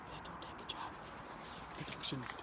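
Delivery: unvoiced whisper of a title. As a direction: breathe it like a secret announcement.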